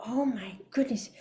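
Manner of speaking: whispered little voice on the shoulder; awed whisper. Acted. One shoulder voice urging her on, awed whisper. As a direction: whisper it in mock awe.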